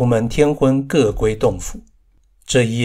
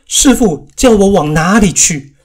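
higher, louder cry of a tearful disciple; tearful plea; 6 semitones higher, 5 dB louder than usual. A narrator's reading. Wukong, eyes full of tears, pleading with his master, higher and louder. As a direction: beg through tears not to be sent away. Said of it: "满眼堕泪道".

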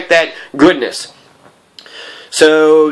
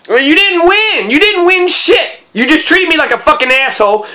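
bitter outburst; 10 semitones higher than usual. Real, not acted. Angry retort at her.

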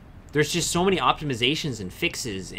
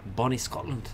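badly faked Scottish accent, softer; horrible Scottish accent; 6 dB quieter than usual. Streamer greeting a Scottish viewer in a bad Scottish accent. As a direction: put on a hammy, dodgy Scottish accent. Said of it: "Was that a horrible Scottish accent".

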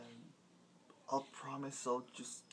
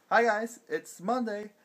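lightly voiced, higher and brash; cocky ginger bandmate; 3 semitones higher, 6 dB louder than usual. Parodying the ginger bandmate's vlog, brash and cocky. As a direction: show off like the awesome one. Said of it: "I'm still ginger and awesome".

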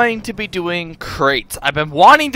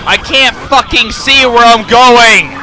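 frustrated yell; 4 semitones higher, 7 dB louder than usual. Real, not acted. Frustrated yelling.

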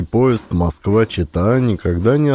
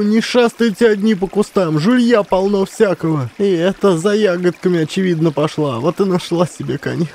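1 scolding woman; 9 semitones higher than usual. A narrator's reading.

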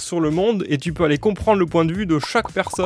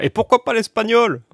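mock objection; 7 semitones higher, 4 dB louder than usual. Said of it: "ils vont dire".

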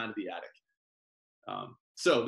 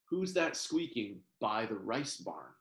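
usual speaking voice

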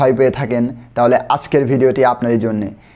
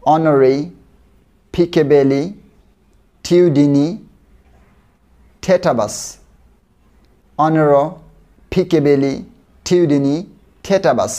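one chunk at a time; much slower than usual. Shown as a demonstration. Teacher splitting a word into pieces for students, slow. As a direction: separate chunks with long pauses. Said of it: "চলুন আমরা আস্তে আস্তে শিখি".

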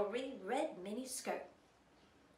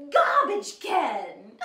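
garbage can, slow and loud; 3 semitones higher, 13 dB louder, much slower than usual. Acted.

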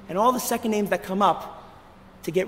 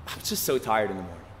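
whiny tired excuse; 7 dB quieter than usual. Pretend. Mimicking a congregant's lazy excuse.

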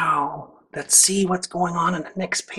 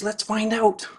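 usual speaking voice

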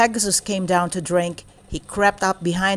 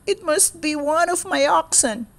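sadly; 9 semitones higher than usual. Acted. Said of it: "he said sadly".

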